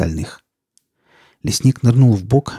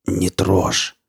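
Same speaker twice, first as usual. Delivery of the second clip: through gritted teeth; 2 semitones lower than usual. A narrator's reading. Injured forester warns the girl off, through gritted teeth. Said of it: "процедил сквозь зубы".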